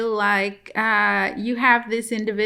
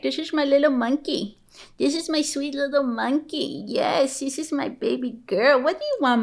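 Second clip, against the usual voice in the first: higher, cooing pet voice; 4 semitones higher than usual. Real, not acted. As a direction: coo over a beloved little dog. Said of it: "talk to her in that voice".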